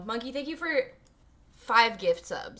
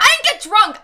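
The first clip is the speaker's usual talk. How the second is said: loud, high-pitched denial; real indignant yelp; indignant protest; 11 semitones higher, 11 dB louder than usual. Real, not acted. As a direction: burst out defending yourself. Loudly denies teasing about being drunk.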